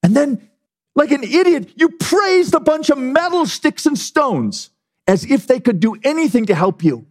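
high, fervent preaching rebuke; heated sermon rebuke; preaching at full force. Acted. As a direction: thunder at the king like a prophet. Preacher condemning Belshazzar.

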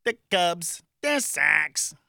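put-on Midwest accent, punchy and drawn out. Shown as a demonstration. Radio host showing off Midwest accent, exaggerated. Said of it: "I can do the Midwest".

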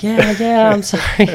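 high, loud imitation of crying; half-played happy crying; mock tearful. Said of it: "I just started crying".

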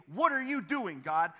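loud, high, indignant demand; arrogant challenge. Acted. Questioner standing before God with an arrogant attitude, demanding. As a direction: imitate someone insolently calling God out. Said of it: "with an arrogant attitude and say".

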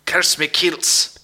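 slow mock-Scottish accent; put-on Scottish accent, taken slowly; attempted Scottish accent. Shown as a demonstration. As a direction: draw out a joke Scottish oath. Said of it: "can anyone here do a Scottish accent?".

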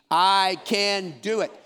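mean every word of "I can do it". Shown as a demonstration. Pastor leads the congregation in a firm, slow affirmation. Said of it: "Say it with me with meaning".

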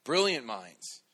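normal talking voice

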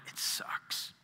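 breathy whisper of a glum reply; whispered honest answer. Retold or imitated. Greeting at church answered with a whisper. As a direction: whisper it like a confession.

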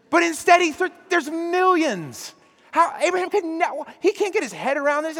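preacher stunned by the size of the promise; amazed outburst. Real, not acted. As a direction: burst out in astonishment. Preacher marvelling at the millions.

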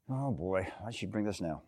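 low, very soft mumble to oneself; muttered aside. Acted. Jesus to himself about Mary's request, muttered aside. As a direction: grumble it under your breath.

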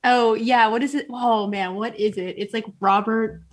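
normal talking voice

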